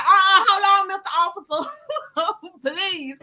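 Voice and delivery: high, whiny playacted begging; mock pleading. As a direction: beg the officer to wait, hammed-up. Retold pleading with the cop.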